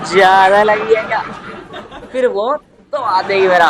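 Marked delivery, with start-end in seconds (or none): 0.1-1.2 put-on auntie voice with a laugh; nosy auntie concern. Neighbor lady asks the boy how badly he's hurt. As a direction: fake concern while grabbing the arm. Auntie asking about injury.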